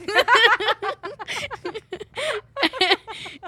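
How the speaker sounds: long loud giggling; loud giggling fit. Real, not acted. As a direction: crack up laughing. Laughs at own joke.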